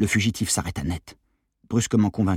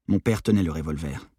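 plain reading voice

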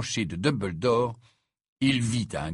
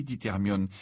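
plain reading voice

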